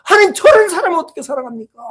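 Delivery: high, loud, indignant plea; angry protest. Retold or imitated. Preacher asking God how to love such a person, angry outburst. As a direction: re-enact crying out to God in anger. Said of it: "화를 내면서".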